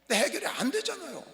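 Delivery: ordinary talk, nothing marked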